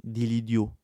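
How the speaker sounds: ordinary delivery, nothing marked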